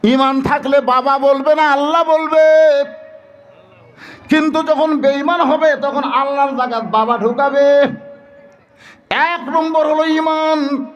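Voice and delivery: very high, ringing oratory; impassioned preaching. Real, not acted. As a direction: proclaim it to the congregation. Preaches at full pitch.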